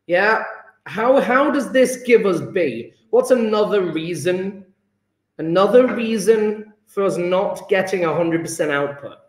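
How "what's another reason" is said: ordinary talk, nothing marked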